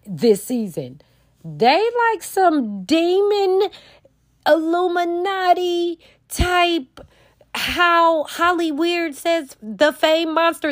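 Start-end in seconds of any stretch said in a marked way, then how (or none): 1.4-10.7 ominous reveal. Real, not acted. Dramatic conspiracy reveal.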